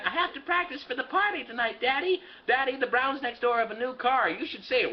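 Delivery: put-on a high, eager little girl telling Daddy; lightly voiced little girl. Daughter Janie excitedly tells her father, high little-girl voice.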